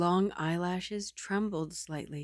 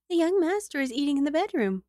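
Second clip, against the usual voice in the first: higher and louder, bright maid greeting; the maid greeting the madam, higher and louder; young maid's voice; 8 semitones higher, 4 dB louder, faster than usual. Acted. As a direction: greet your mistress eagerly as a maid.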